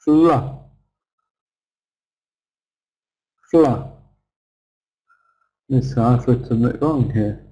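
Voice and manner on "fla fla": "Fla" punched short with an ah vowel; strong stressed syllable. Shown as a demonstration. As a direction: isolate "Fla" as one strong short beat. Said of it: "strong on the first syllable".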